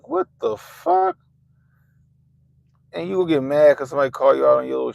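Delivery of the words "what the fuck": high, drawn-out shocked cry; shocked what the. Retold or imitated. Retelling his shock at seeing the lashes, high and slow. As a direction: recoil at the sight, voice leaping up. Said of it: "I was like".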